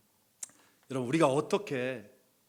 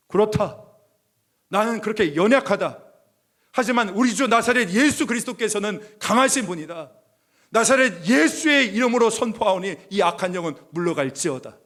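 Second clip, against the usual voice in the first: higher, louder rebuke of an evil spirit; commanding proclamation; 8 semitones higher, 12 dB louder than usual. Retold or imitated. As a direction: thunder out the command in Jesus' name. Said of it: "제가 오히려 선포했어요". (악령을 꾸짖듯 높고 크게 선포)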